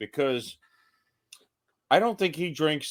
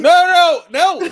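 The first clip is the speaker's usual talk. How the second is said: much higher, louder no's; loud protest; 13 dB louder than usual. Real, not acted. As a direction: yelp the denial. Protesting cohost's question.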